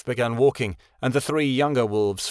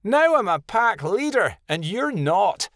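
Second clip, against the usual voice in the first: put-on higher, taunting young wolf brag; higher, louder boastful taunt; bragging; 10 semitones higher, 3 dB louder, slower than usual. A narrator's reading. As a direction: rub it in his face.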